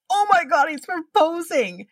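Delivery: high-pitched, astonished exclamation; re-enacted shock. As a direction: relive the shock, pitch jumping way up. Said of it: "I was shocked".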